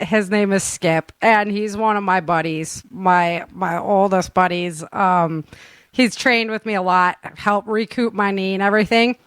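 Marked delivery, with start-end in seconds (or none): none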